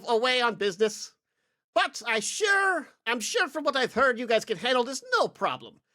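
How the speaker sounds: high, airy aristocratic voice, pitched well up; lightly hammed-up posh noble; fussy noble lord. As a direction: play a pompous noble fumbling his words.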